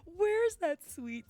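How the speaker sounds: laughing repeat. Shown as a demonstration. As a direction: relish "simpulation" gleefully. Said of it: "'cause it's so good".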